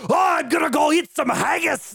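exaggerated Scottish accent shout; mock Scottish Gerard Butler. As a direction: belt it out like an over-the-top Scotsman. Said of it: "he gets to use his Scottish accent".

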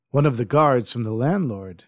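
ordinary delivery, nothing marked

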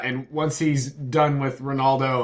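usual speaking voice

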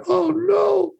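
high, wailing sob of despair; crying wail. A narrator's reading. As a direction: break into a high cry over the book. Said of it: "and he starts to cry".

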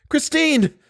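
very high calling cry. A narrator's reading. Chagny calls Christine from flood, high cry. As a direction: call her name desperately, high. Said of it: "cried Monsieur de Chagny".

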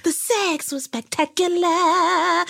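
high, slow, theatrically drawn-out rave; hammed-up quote. Host quoting the woman's verdict on the date, hammed-up rave. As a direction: stretch each word in mock rapture. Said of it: "That's what she says".

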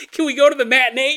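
a bored pal begging for an afternoon movie; mimicked friend. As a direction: nag him like a restless buddy. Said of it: "You're like".

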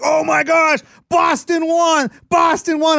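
high, loud, slow excited yell; excited kids shouting. Retold or imitated. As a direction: cheer the win like a thrilled kid. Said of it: "They come down like".

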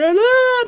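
high, loud imitated kid scream; mock scream. Stung boy running in to friend's mom, mock screaming. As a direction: wail it like a stung little boy. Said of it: "heard me screaming".

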